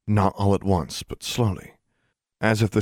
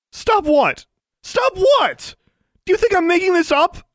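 shrieked, very high and loud challenge; furious shouting; 7 dB louder than usual. A narrator's reading. Daughter refusing to be silenced by mother, furious shouting. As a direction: yell back at her in outrage. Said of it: "Stop shouting!".

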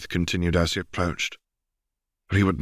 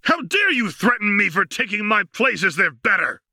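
much higher, louder screaming rant; red-faced and spitting with rage; furious screaming; 14 semitones higher, 4 dB louder than usual. A narrator's reading. Slave master raging at the Wraith, screaming fury. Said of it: "the master screamed this time".

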